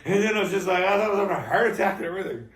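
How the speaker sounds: loud, high and fast with laughter; laughing. Real, not acted. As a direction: rush it out, still laughing. Still laughing at own story.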